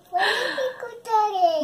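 laughing. Real, not acted. Child laughing while answering mum.

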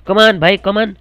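loud cheer at the screen; excited cheering. Real, not acted. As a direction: urge the player on, shouting. Cheering at the game.

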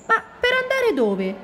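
very high-pitched puzzled question. Acted. Ranocchio wants to know where they would go, puzzled. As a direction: ask it high, wondering where to.

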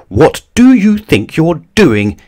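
high, loud, outraged challenge; bossy yell. A narrator's reading. Little Miss Bossy confronting the bear, indignant yell. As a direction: yell it like a scolding boss. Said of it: "yelled little Miss Bossy".

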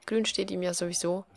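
usual speaking voice

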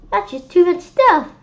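loud, high exclamation; exasperated. A narrator's reading. Friend reacting to Neil's overloaded costume, loud and high. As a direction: throw your hands up.

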